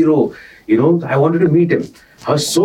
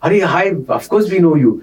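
bright friendly greeting; 6 semitones higher, faster than usual. Retold or imitated. Retold Anjali greeting warmly.

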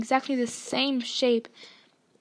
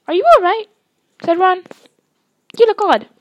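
much higher, much louder worried call; concerned; 6 semitones higher, 13 dB louder than usual. A narrator's reading. Scene: Ron to a distracted Harry, loud worry. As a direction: jolt him out of his daze.

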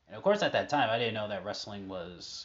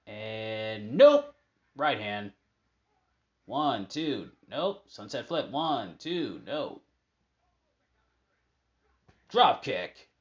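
excited live match calling, a bit higher; lively ringside commentary; play-by-play; 4 semitones higher, 4 dB louder than usual. Acted. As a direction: call each move as it happens.

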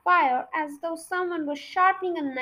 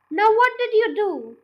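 raised, eager question; impatient cry; 5 semitones higher, 6 dB louder than usual. A narrator's reading. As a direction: demand the next step at once. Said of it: "cried Mister Cranky".

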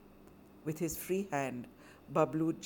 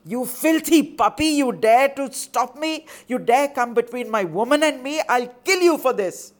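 raging threat; 8 semitones higher, 12 dB louder than usual. Acted. Drunk brother threatens the boy.

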